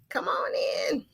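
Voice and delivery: whispered invitation, soft and secretive. Acted. Whispered invite after plain calls.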